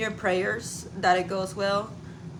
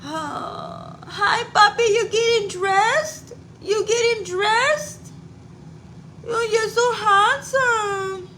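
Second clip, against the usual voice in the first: much higher, louder, slow puppy talk; 12 semitones higher, 6 dB louder, much slower than usual. Real, not acted. Mom greeting her puppy in high, slow baby talk.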